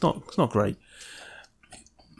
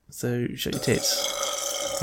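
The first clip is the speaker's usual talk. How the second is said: slow whispered jab; 6 dB quieter, much slower than usual. Pretend. Jokey insult to friend.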